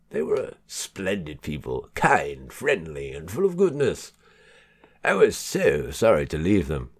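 hammed-up posh accent with swooping pitch; mock-posh English. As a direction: play it posh for fun. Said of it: "felt the urge to do a posh voice".

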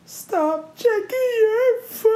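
high, drawn-out mock-crying wail; retold tearful wail. Retelling a plea to the boyfriend, tearful wail. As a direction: wail it slowly, imitating a crying meltdown. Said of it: "And then the amount of tears".

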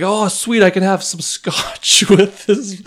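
high, loud mock excitement, slowed down; doing a giddy voice for the co-host; mock excited. Co-host quoted as giddy about having scotch on the call. Imitating co-host's excited reply.

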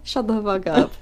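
ordinary talk

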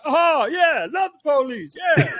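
high, put-on reluctant agreement. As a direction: humor him in a squeaky fake voice. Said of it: "He's like".

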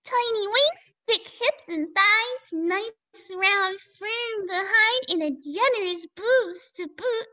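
slow, drawn-out fanfic reading; dramatic reading. Acted. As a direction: savor each word.

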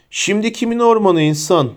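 mild acted yell, high-pitched; high, taunting challenge; shouted. A narrator's reading. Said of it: "diye bağırdı Bane". (hafifçe bağırarak)

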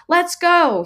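high, loud urgent shout; frantic shout to the family. A narrator's reading. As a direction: yell it down the hallway. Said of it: "he shouted again".

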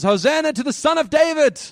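high, loud Hosanna cry; crowd's acclaiming shout. Acted. Everybody hailing Jesus riding into Jerusalem, high jubilant shout.

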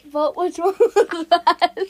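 loud, slow, cracking up while talking; words dissolving into loud laughter; laughing. Real, not acted. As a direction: fight through giggles to finish. Breaks up laughing at uwu poll.

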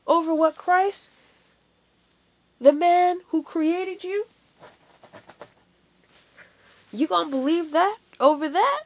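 loud, high, pressing each word home; rising preacher's challenge. Real, not acted. Preacher pressing the congregation to choose Christ, loud and rising. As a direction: hammer the question at the congregation.

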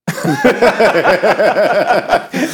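high, loud burst of laughter; big laugh. Real, not acted. Laughing at [name]'s Hello Dolly joke.

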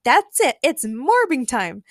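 high, excited exclamation, slowing down; shouted. A narrator's reading. Goggles lady solves the mystery, shouting. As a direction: shout it, drawing out the end. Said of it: "shouted a lady with goggles".

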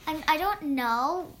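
ordinary talk, nothing marked

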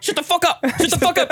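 high, urgent mock hushing; hammed-up alarm, pitch way up; panicked guard hushing. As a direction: hush a bird in a high panicky voice.

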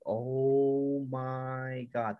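very slow, long drawn-out "Oh my God"; awed. Real, not acted. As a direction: stretch it out in amazement. Amazed by the new transformation.

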